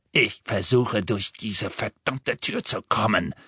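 coarse, irritated character voice, held in; grumbling brute's reply; exasperated call. A narrator's reading. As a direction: force it out with contained exasperation. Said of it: "rief Amicus".

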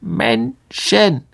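high, alien spider voice; clicking spider. A narrator's reading. Spider reports humans to Aragog, high creature voice. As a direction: spit the word out like a clicking insect. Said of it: "klickte die Spinne".

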